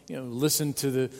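usual speaking voice

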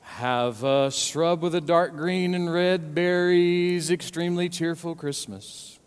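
slow, deliberate mock carol line; slow literal reading. Shown as a demonstration. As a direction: spell out each word slowly. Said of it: "something like this".

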